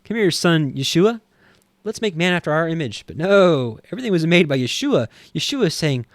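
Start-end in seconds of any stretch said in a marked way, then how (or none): 0.0-3.0 higher, louder voice of a father beckoning; acted fatherly call, pitched up; Father calling his son. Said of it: "this is the Father saying".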